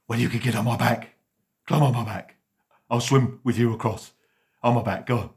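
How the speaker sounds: booming, eager dog voice; hearty loud dog. Acted. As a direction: call it out like a big friendly dog. Dog offers to carry cat.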